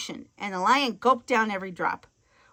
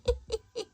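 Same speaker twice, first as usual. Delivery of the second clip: witch laugh; 12 semitones higher, 7 dB quieter than usual. Acted. Witch laughs after order.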